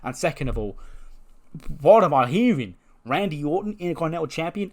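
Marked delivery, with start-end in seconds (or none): 1.8-4.7 loud outraged disbelief, pitch jumping up; acted incredulity. Wrestler scoffing at a rival's title claim, incredulous. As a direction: question it as if it were absurd. Voicing an indignant wrestler's objection.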